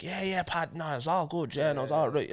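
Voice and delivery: imitating a relaxed Irish accent. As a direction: slip into a casual Irish accent for a mate. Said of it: "if I'm talking to Irish dude right now, I'm like".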